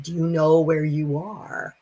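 slow mock booming voice from the dark. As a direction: boom the question out slowly, like an unseen judge. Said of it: "this voice came booming out and said".

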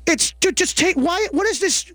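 ordinary talk

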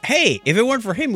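usual speaking voice

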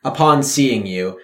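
usual speaking voice

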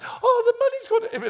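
high, loud mock panic; hammed-up trader in alarm; dismayed cry. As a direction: wail over the spilled coins. Acted trader losing the money.

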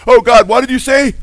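high, loud put-on cry to God; mock prosperity teacher. Preacher parodies teachers claiming to hear God, mocking. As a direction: call out in showy, hammed-up astonishment.

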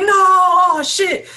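a high, loud burst of shock; retelling his reaction to the fight; shocked outcry. Retold or imitated. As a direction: yelp at the sight. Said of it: "I was like".

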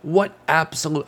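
high, emphatic marvelling that sounds sarcastic; sarcastic-sounding. Real, not acted. Podcaster delighted the profile came out today, sincere but sounding sarcastic. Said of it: "I know I sound sarcastic".

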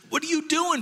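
high-pitched mock outrage; exasperated interruption. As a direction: break in, appalled and squawking. Balak interrupts second blessing.

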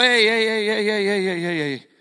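loud run of interjections, tapering down; calling for attention. Acted. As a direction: cut into a heated debate, then ease off. Peter breaks into a heated debate.

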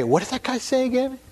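high, puzzled mock voice of a churchgoer who forgot; mock forgetful listener. Believer asked about hell, fumbling, in a high puzzled mock voice. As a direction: pitch it up like someone groping for a half-remembered sermon. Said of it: "or you could say".

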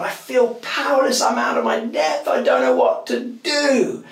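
high, loud anguished cry, slowing on the last line; desperate cry. Retold or imitated. Pastor retelling his sobbing prayer to God, crying out. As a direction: cry out to God at the end of your strength.

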